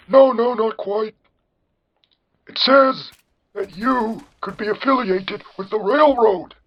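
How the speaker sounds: put-on slow, high old-man voice; John Henry. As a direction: draw out the accusation in a cartoon falsetto. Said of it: "you have the generic old man voice".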